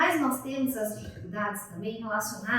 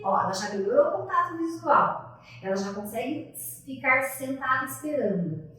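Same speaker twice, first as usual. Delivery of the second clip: normal talking voice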